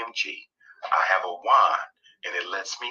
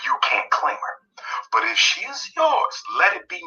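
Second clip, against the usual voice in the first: preaching warning; 12 semitones higher, 3 dB louder than usual. Real, not acted.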